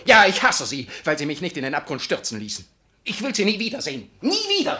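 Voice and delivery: high, loud hateful shout; shouting rage that peaks at the end; furious outburst. Acted. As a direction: hurl it at her, rising to a shriek. Vicar rejects Frau Sundler.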